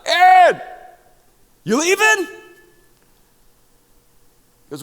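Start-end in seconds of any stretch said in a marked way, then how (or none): 0.1-2.3 much higher, louder call across the lot; yelled out. Retold or imitated. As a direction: call out to him from far away. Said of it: "yelled out".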